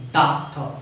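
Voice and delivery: doctor with the final R dropped; silent R. Shown as a demonstration. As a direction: end "Doctor" on a vowel, no R. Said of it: "R is silent".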